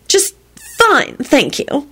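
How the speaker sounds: high, drawn-out huffy reply; scowling. A narrator's reading. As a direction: say it through a scowl, high and slow. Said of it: "she scowled".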